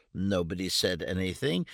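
ordinary delivery

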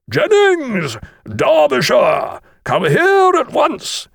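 loud, high, drawn-out schoolmaster's shout; bellowing. A narrator's reading. Master summons two stray boys, slow powerful bellow. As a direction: bellow across a field at full power. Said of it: "bellowed at the top of his powerful voice".

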